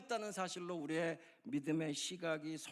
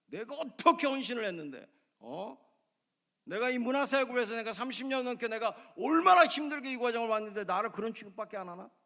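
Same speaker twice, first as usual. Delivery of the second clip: high, loud indignant outburst; surging anger; 7 semitones higher, 9 dB louder than usual. Acted. Thirty-year culture minister protesting poor treatment, angry outburst. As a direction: let resentment boil over, loud and high. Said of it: "갑자기 막 치밀어 오르는 거죠".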